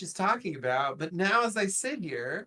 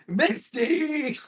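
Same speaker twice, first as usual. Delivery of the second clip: higher-pitched, hammed-up cry of blame; mock scolding call; 8 semitones higher, 3 dB louder than usual. Co-host blaming Misty for everything, mock scolding. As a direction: call out the name in playful exasperation.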